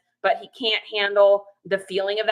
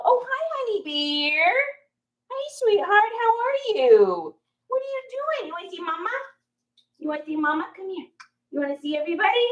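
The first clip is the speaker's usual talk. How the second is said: cooing pet names; 9 semitones higher than usual. Real, not acted. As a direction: coo at the puppy, much higher. Talking to her dog.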